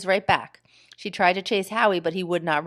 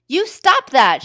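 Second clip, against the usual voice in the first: higher, louder angry snap; 8 semitones higher, 8 dB louder, slower than usual. A narrator's reading. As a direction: stamp and scold. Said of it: "she said angrily".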